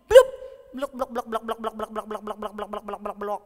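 rapid glugging blok-blok; plop, then a run of sinking bubbles; imitated bubbling. Gift dropped into the water jar, imitated bubbling.

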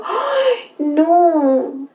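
high pitying "ay, no"; mock pitying cry. Streamer reliving the gym scene, high pitying cry. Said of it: "me dio pena por el man y yo".

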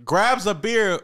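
usual speaking voice

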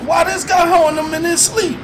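high fast disbelieving yelp; can't believe it, ask fast; incredulous. Acted. Friend baffled by the dream debt, yelping high.

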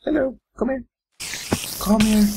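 very high, sing-song call to a pet; coaxing pet voice. Acted. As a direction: lure it in like calling a puppy.

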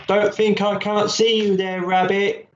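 put-on a playful small boy; child voice. A narrator's reading. Child Billy voiced in story.

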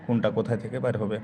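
ordinary delivery

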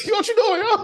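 high, laughing mock fright; squeaky startled yelp. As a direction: squeal it like you got startled.

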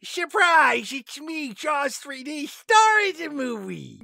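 high, proud monster announcing itself; a shark boasting it is the star; gleeful shark voice. Acted. Shark greets its victim, playful villain.

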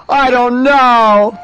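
slow, drawn-out mock wail; tearful wail. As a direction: bawl it out slowly, hammed-up. Crier asked about his powerful cry.

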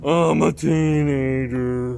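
put-on slow, loud mopey teen voice; teenager voice. Shown as a demonstration. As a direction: drag it out like a sulky teen. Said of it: "Let's hear your teenager voice".

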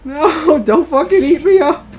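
high, loud nervous cry; giddy fright; laughing panicked plea. Real, not acted. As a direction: plead loudly through nervous laughter. Pleading with creature, laughing.

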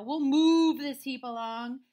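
Bossy Cow. Acted. Cow takes charge of pushing, loud bellow. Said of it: "said Bossy Cow".

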